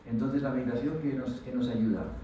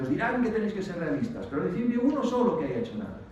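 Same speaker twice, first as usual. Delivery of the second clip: strong-voiced speaker; 7 semitones higher than usual. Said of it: "hablaba así con voz fuerte".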